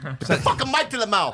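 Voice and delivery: angry command to get on the mic. Real, not acted. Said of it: "Don't get angry".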